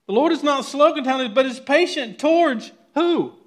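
high, loud fiery preaching; full-force preaching. Acted. Pastor pressing his church, full-force preaching.

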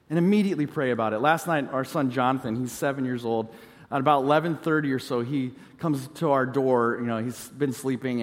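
ordinary talk, nothing marked

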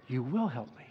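low, soft, settled assurance; quiet confident trust. Shown as a demonstration. Preacher showing the prayer after the change, quiet trust. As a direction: say it softly with calm certainty. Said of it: "It was dramatic, this change".

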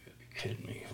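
breathless whispered protest; whispered disbelief. Retold or imitated. Preacher answering the Lord in a disbelieving whisper. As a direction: whisper it, stunned. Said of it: "And it's like".

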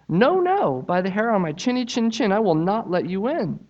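high, squeaky pig refusing; the little pig digs in, high; defiant little pig. Acted. Little pig refusing the wolf, high defiant voice.

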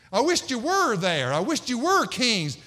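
loud, high mock wishing; sarcastic. Preacher voicing Paul to self-crowned Corinthians, sarcastic. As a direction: punch WERE with exaggerated longing. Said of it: "I think this is even sarcastic too".

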